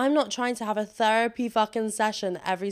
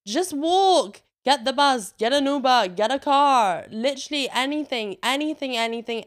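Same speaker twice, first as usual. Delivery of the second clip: loud, higher, scolding; exasperated rant; 4 semitones higher, 6 dB louder than usual. Acted. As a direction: yell it at the scooter riders. Ranting at scooter riders.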